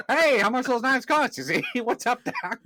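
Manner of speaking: high, loud, laughing cartoon rabbit voice; a bad Bugs Bunny put on through laughter; hammed-up cartoon bunny, cracking up midway; Bugs Bunny impression. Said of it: "That's a terrible impression".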